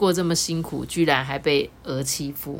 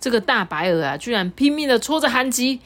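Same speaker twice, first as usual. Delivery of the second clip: usual speaking voice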